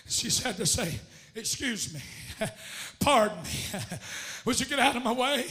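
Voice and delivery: rhythmic storytelling with audible gasps; preaching. Real, not acted. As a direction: build the Bible story breath by breath. Tells story in gasping preaching cadence.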